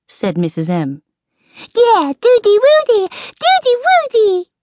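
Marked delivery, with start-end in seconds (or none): 1.7-4.5 very high, loud, excited squeal; piercingly high squeaky cheer; squeaky little creature. A narrator's reading. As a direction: squeak it out like an overjoyed toddler. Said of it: "squeaked Squeak".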